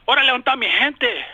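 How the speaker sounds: lively Mexican Spanish call-out. Retold or imitated. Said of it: "If I was to say".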